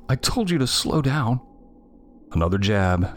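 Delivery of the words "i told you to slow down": higher, peeved sister's reproach; annoyed, blaming sister voice; grumbling. A narrator's reading. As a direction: grumble an I-told-you-so, pitched up. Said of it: "she grumbled".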